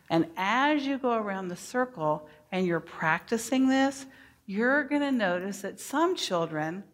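ordinary talk

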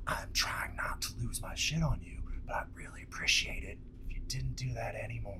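soft, controlled threatening whisper; barely audible, calm but dangerous; menacing whisper. Shown as a demonstration. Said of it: "The second guy who whispers".

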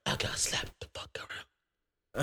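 whispered, almost voiceless; hushed whisper. Retold or imitated. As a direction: drop to a confiding whisper. Retelling childhood punishment.

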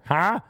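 high, short "huh"; mock orgasm cry. Woman in bed having an orgasm, high mock huh. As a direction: imitate an odd high climax yelp. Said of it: "having an orgasm, she'd be like".

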